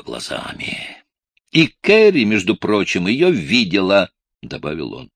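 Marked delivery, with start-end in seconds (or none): 1.5-4.1 angry outburst. Acted. Boy angrily accuses Mr Evans.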